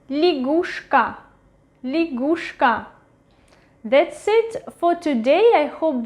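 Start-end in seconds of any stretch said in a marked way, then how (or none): 0.1-2.9 лягушка with я as i, stress on the u; reduced unstressed Ya. Shown as a demonstration. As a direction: say лягушка as li-GUSH-ka, carefully modelled. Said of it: "It is pronounced as И".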